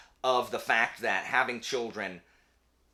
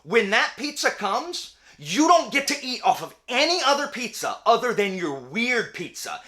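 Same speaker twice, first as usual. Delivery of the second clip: much higher and louder, insistent; passionate rant; 12 semitones higher, 9 dB louder than usual. Real, not acted. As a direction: lecture the weird topping eaters hotly. Mock rant against pizza toppings.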